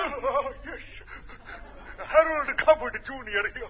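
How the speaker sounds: slow, lofty upper-class drawl; pompous stockbroker. Acted. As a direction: introduce yourself grandly, drawn out.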